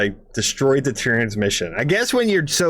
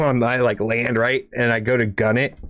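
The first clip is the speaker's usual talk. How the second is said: ordinary talk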